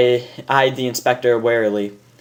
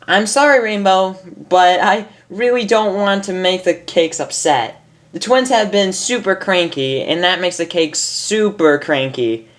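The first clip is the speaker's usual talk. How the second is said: higher, apologetic bubbly pony; Pinkie voice; 6 semitones higher than usual. Acted. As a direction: say sorry sweetly.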